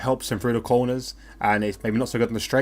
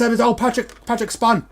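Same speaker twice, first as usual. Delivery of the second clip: much higher and louder as the car spins; excited commentary; 7 semitones higher, 5 dB louder than usual. Real, not acted. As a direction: call the spin live, voice shooting up. Car spins, live reaction.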